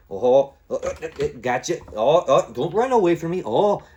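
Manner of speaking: loud, hammed-up Irish gamer taunts; bad Irish accent. Shown as a demonstration. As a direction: chase them down in a badly faked Irish accent. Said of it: "like a really shitty Irish impression".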